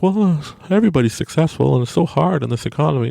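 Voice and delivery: lightly hammed-up whine about how hard everything is; whiny complaining voice. Host mocking a negative listener, whiny complaint.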